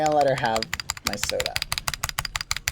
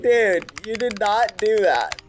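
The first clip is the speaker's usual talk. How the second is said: higher, slow disbelieving outrage; slow, raised voice of mock outrage; 8 semitones higher, 4 dB louder, slower than usual. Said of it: "I was like".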